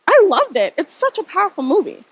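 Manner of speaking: pretended enthusiasm. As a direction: gush as if you had really seen it. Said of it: "decided to lie and pretend".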